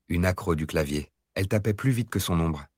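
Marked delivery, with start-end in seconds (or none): none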